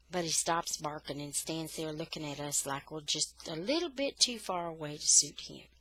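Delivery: plain reading voice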